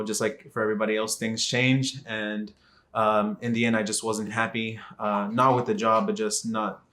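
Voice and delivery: ordinary delivery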